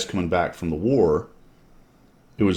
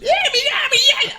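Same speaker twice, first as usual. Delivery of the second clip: much higher, lilting sing-song yelps; 3 dB louder, much faster than usual. Retold or imitated. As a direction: mimic a squeaky sing-song chorus. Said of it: "That, that lilting, sing-song, high-pitched".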